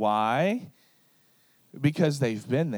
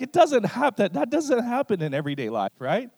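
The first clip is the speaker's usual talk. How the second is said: incredulous; 9 semitones higher, 4 dB louder than usual. Retold or imitated. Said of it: "And you're like".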